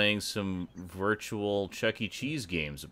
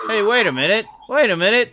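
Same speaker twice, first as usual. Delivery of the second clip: higher, louder excited call; 9 semitones higher, 5 dB louder, faster than usual. Real, not acted. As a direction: perk up with sudden excitement. Excited spotting newcomer.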